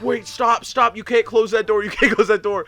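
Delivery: high, loud mock alarm; retold urgent objection. NCP runs up to stop a door closing, mock urgency. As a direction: imitate a coworker rushing to stop you.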